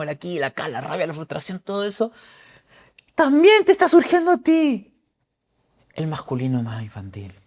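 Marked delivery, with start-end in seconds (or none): 3.1-4.9 high, loud and strained; loud emphatic. Acted. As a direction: push it out with force. Enfático sobre el berrinche interior. (grito enfático)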